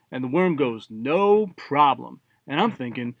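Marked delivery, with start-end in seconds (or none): 0.9-2.2 slow, drawn-out, easygoing assent; easy agreement. Acted. Worm to god, agreeing to eat the tree, drawn-out and easygoing. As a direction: stretch both words out agreeably.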